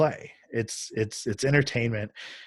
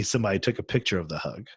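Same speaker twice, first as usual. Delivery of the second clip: ordinary delivery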